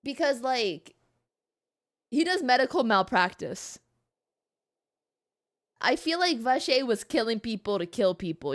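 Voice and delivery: ordinary talk, nothing marked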